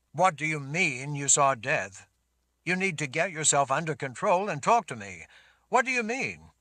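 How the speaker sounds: loud, stern and insistent; commanding Richard. Acted. Richard presses Sammy.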